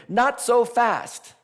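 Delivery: high, slow booming guardian voice; a mock gatekeeper halting a traveller; guardian's challenge. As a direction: stop the hero in his tracks. Threshold guardian blocking the hero.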